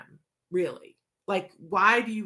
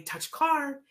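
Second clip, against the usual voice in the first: bright upbeat higher prompt; exciting; 8 semitones higher than usual. Shown as a demonstration. Therapist prompting a child to touch the car, excited. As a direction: sound eager, like it's a treat. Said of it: "and make it exciting".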